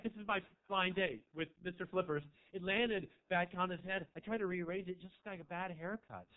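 usual speaking voice

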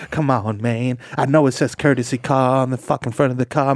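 ordinary delivery

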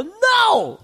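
high-pitched drawn-out no; emphatic refusal. Retold or imitated. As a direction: act out an indignant refusal way up high. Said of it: "when I go".

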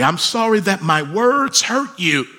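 earnest plea for forgiveness; fatherly apology. Acted. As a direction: raise the voice in a heartfelt sorry. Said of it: "if our children heard us say these things".

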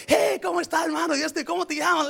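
high cheerful hey; friendly greeting. Retold or imitated. Pastor imitates Brother Jorge greeting a stranger, cheerful. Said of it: "Como yo miro a hermano Jorge".

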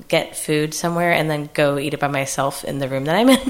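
usual speaking voice

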